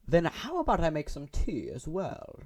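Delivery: put-on slow, posh-ish British accent line; offering tea in a put-on British accent. Voicing Monika offering tea, in a British accent. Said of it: "I had to say it in a British accent".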